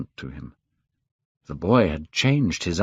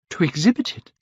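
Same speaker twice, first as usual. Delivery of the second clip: higher, shocked disbelief on exhibit; alarmed cry; 9 semitones higher, faster than usual. Acted. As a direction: gasp it out, aghast. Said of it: "cried Dorian Gray".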